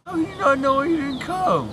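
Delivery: normal talking voice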